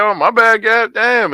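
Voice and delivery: playful apology. Retold or imitated. Dad mock-apologizing to his daughter, hammed up. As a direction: throw the apology out big and silly. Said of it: "I be like".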